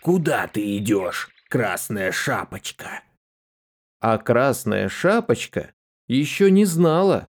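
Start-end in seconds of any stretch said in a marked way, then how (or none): 0.0-3.0 low gruff wolf; lower, growly big bad wolf. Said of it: "Облизнулся волк и спрашивает".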